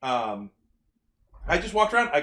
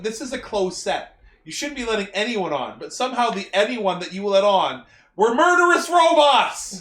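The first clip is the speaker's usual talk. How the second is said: mock scolding, building louder and higher; mock exasperated rant; 5 semitones higher, 5 dB louder than usual. Podcaster chewing out the imagined security team, mock exasperated. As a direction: build a playful telling-off into exasperated yelling.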